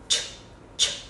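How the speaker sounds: "ch" thì thầm bật mạnh hơi; "ch" đẩy hết hơi ra, không thanh. Shown as a demonstration. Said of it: "Nói dần rồi nó sẽ quen". (phụ âm "ch" bật hơi kiểu tiếng Trung)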